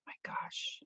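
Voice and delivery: soft stunned whisper; whispered gasp. Real, not acted. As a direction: breathe it out in disbelief. Reacting to comment.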